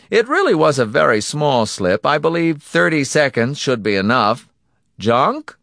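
high, loud, brisk-minded villain; businesslike villain. Acted. Donati ordering the punishment begun, coolly businesslike. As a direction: run the cruelty like routine business.

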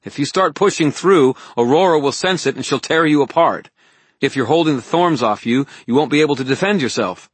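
high, loud, urgent woman's warning; Elaine warning urgently. Acted. Voices Elaine warning Harry.

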